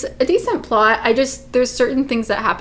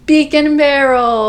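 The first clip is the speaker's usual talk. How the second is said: loud, higher and slow reveal; drawn-out announcement; 4 semitones higher, 6 dB louder than usual. Real, not acted. Host revealing today's topic, drawn-out loud announcement. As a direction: stretch out the big reveal, loudly.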